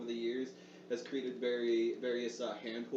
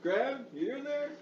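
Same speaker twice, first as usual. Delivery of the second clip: high call through the door; a raised shout through the door; 13 semitones higher, 3 dB louder than usual. Retold or imitated. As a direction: call out to check on her. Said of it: "shout out".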